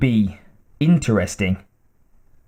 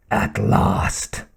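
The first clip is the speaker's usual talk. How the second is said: murmured whisper; 4 semitones lower than usual. Acted. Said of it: "he murmured".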